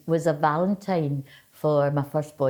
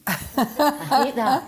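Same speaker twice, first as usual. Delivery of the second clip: squeal of disgust; 9 semitones higher, much slower than usual. Real, not acted. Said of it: "hating the poetry voice".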